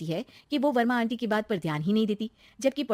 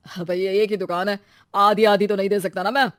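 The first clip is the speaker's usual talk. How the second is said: refusal growing louder; irritated shop owner; 7 dB louder than usual. Acted. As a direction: turn them down with impatience. (झुँझलाया दुकान मालिक)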